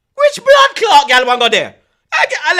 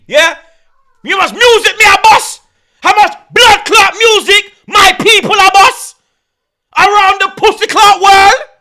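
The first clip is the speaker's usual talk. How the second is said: loud, higher bragging rant; boastful shout; 5 semitones higher, 4 dB louder than usual. Acted. Boasting that Jamaican music runs the world, loud shout. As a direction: shout the brag proudly.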